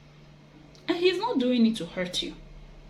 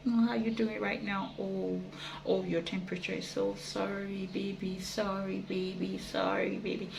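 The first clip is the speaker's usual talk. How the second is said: tender caring; 7 dB quieter than usual. Retold or imitated. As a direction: coo over a sick partner, much softer. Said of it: "you're expecting him to come out".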